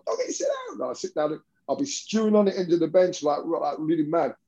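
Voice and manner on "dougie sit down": high, pinched mock coach voice; a teasing coach's order, pitched way up; imitating coach Fisher. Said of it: "he goes".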